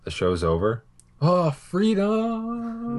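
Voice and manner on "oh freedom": very high, long-held cry of freedom; mock jubilation. Co-host hears the show may be over, mock jubilation. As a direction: belt it out slowly, hammed-up relief.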